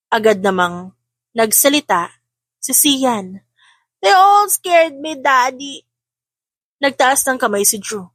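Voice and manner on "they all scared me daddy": higher, slow, small frightened voice; cling to daddy and plead; scared little child. A narrator's reading. Youngest to her father: slow, high, scared voice.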